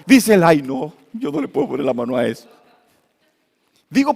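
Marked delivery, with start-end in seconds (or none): 0.4-2.5 quick, lower, whiny refusal of a frightened man; scared husband. Retold or imitated. Strong husband begging the pastor off, quick and frightened. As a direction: back away fast from the snake.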